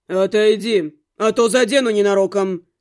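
low man's voice, contained threat; dark, controlled male warning; menacing warning. A narrator's reading. Man warning her through the door.

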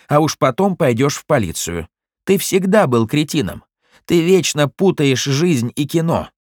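distinct and spiteful. Acted. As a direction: relish every spiteful word. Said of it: "заговорил отчётливо и злобно".

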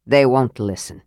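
low, resigned grim remark. A narrator's reading. Dwarf tells companions it is hopeless, grim. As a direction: say it low, grimly sure. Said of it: "he said grimly".

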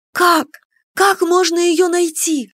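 high, urgent, distressed question; pleading question. A narrator's reading. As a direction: beg desperately for a way. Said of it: "вопрошала я". (отчаянный вопрос высоким голосом)

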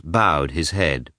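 ordinary delivery

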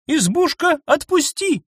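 high indignant command; indignant order. A narrator's reading. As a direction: order the hut, outraged. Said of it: "возмущённо приказала Анна".

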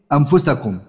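ordinary delivery

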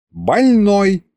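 high, sharp stern call; stern doctor. A narrator's reading. The doctor scolds the patient sternly, pitched high. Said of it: "строго погрозила".

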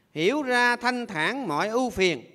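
ngân chậm từng chữ; ngâm như câu kệ; ngâm thơ. Acted. (giọng ngâm thơ chậm)

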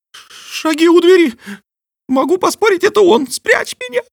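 loud high terrified bat; panicked bat voice. Acted. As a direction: beg to hide in panic. Bat hears vicar coming.

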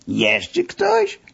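high astonished question; surprised. Acted. Masseur surprised by the knock, high astonished voice. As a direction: caught off guard by a knock. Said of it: "Zdumiał się pan Siasiu".

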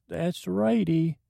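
a quick nod in the goofy voice; a touch of James. Pretend. Brief James voice.

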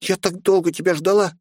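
put-on high, joyful child's voice; happy little girl. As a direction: greet him like a glad child. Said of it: "радостно подбежала".

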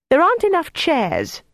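very high, squawky quarrelling voice; indignant protest. A narrator's reading. Jelly snatched Greta's pouffe, indignant protest. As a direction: protest high, dropping at the end.

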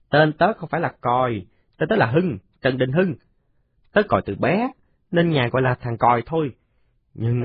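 put-on high, swaggering kid's voice; a tough little boy laying down the law; boastful boy. Còi warns the new boy about his nickname, high and boastful.

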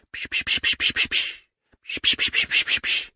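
quick voiceless shushing clicks; bird-calling psch. Acted. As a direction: hiss gently to draw the birds out. Calling birds with psch sounds.

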